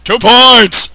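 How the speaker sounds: loud high victory call; sports-fan cheer; triumphant shout. Real, not acted. Celebrating throw.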